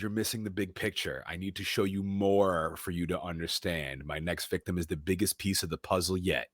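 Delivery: lightly voiced creepy villain; menacing Riddler. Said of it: "Riddler goes".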